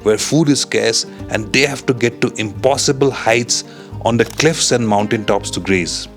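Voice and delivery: calm, measured wildlife narrator; nature documentary narration. A narrator's reading. Wildlife voiceover about ibex.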